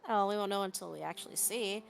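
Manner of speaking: usual speaking voice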